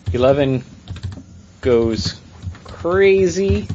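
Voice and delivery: slow, drawn-out movie trailer read; mock movie-trailer voice, drawn out; dramatic trailer narration. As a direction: build suspense word by word.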